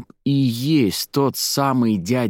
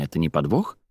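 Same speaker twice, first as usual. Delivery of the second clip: guarded, low question rising at the end; low-voiced, wary question; 5 semitones lower than usual. A narrator's reading. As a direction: ask it suspiciously. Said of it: "насторожилась она".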